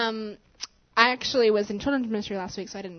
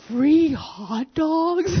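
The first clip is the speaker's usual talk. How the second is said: very slow, higher, louder, drawn out in wonder; excited little boy; 5 semitones higher, 7 dB louder, much slower than usual. Retold or imitated. Little boy thrilled to hear about free hot dogs, slow and loud. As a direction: stretch it out like a thrilled kid. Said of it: "He was just really excited".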